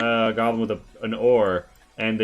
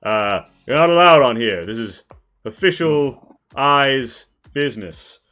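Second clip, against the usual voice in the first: guard shouting; 3 semitones higher, 9 dB louder than usual. Acted. Hobgoblin archer shouting at the party to stay off. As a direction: bark at the intruders to back off. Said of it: "he's like".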